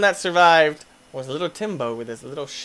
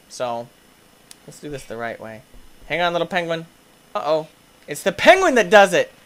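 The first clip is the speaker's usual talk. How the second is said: ordinary delivery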